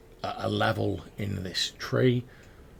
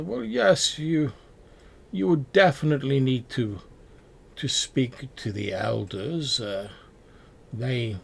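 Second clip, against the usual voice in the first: raised-pitch friendly villager giving directions; tree-dweller guide; 3 semitones higher than usual. Acted. Villager giving directions to travelers, in a raised, helpful voice. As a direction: point the lost travelers toward the Elders.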